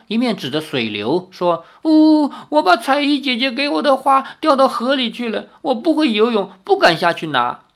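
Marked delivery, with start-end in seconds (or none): 1.9-7.6 put-on high, tearful little boy's voice; a small boy whimpering about his lost flower; child voice, a touch of sobbing; crying child. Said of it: "那孩童一面哭".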